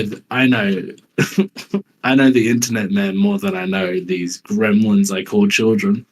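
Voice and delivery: normal talking voice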